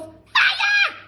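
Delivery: slow, very high, loud screech; panicked shout. Acted. Grandma burning inside, screeching shout. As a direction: shriek for help. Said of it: "the old woman yelled".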